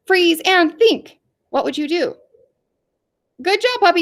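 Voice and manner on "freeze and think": slow, high game command; game leader calling out slowly; foreman's command. Acted. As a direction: stretch out the order in a raised pitch. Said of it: "And if I said".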